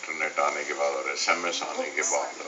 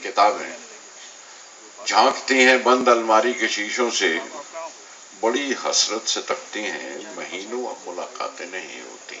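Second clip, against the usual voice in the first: measured verse in separated phrases; poetry recitation; 3 semitones higher, 4 dB louder than usual. Acted. Poet recites a poem on neglected books to listeners, poetry recitation. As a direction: lift each opening line, let the next fall away.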